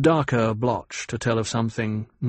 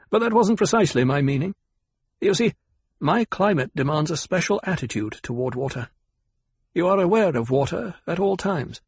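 higher-pitched, precise character voice; Kynes, exacting planetologist; 7 semitones higher than usual. A narrator's reading. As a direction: correct the listener in a lifted voice.

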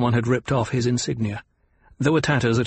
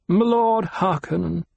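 put-on old doctor voice; 7 semitones higher than usual. A narrator's reading.

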